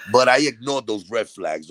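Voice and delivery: usual speaking voice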